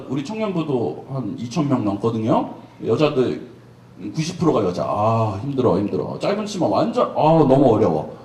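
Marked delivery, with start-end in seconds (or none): 4.8-8.2 low, weary moaning complaint; half-joking sigh of struggle; mock groaning. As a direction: groan like it's too much to bear. Pastor groans about temptation.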